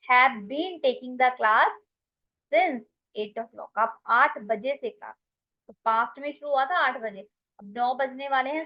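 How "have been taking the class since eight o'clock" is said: very slow, each word laid out for the class; slow dictation. Shown as a demonstration. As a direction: spell it out slowly so students can write it down. Teacher dictates English example sentence. (धीमे-धीमे बोलकर लिखवाना)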